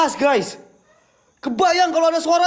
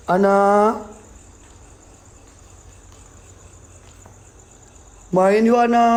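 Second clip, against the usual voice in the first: gentle coaxing call; 8 semitones lower, slower than usual. Acted. Ghost hunter gently invites the child spirit Ana to play, lower and coaxing. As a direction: call her name gently, like luring a shy kid. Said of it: "Ngomongnya lembut".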